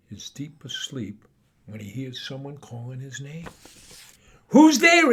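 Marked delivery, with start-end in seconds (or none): 4.5-5.1 high loud call. Acted. Mo calls out into the night, high and loud. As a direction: call into the dark.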